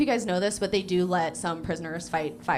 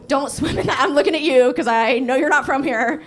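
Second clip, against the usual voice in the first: raised, emphatic call-out to one person; loud mock warning; 4 semitones higher, 7 dB louder than usual. Real, not acted. Comic singling out an audience member about the river, loud warning. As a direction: warn the out-of-towner at full volume.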